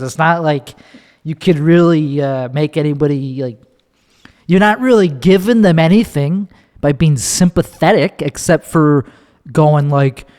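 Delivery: usual speaking voice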